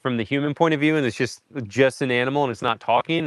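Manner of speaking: usual speaking voice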